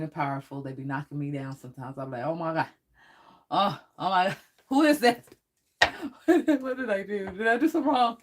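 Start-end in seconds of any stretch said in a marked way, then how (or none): 2.2-8.2 higher, louder, laughing through the words in mock alarm; mock fright, high and loud with laughter; startled laughing panic. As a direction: act out getting spooked by a surprise visitor, half-laughing. Retelling being startled by spirits.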